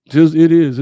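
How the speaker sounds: sudden loud burst; loud exclamation. Real, not acted. To his viewers, a sudden loud fired-up exclamation. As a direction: blurt it out, fired up. Excited aside during sermon talk.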